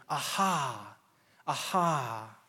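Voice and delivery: understated, cold mocking jeer; cold mockery. Preacher voicing the psalmist's scornful enemies, coldly mocking. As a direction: drop into a drawn-out, bitter aha. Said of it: "those cold, bitter notes of mockery".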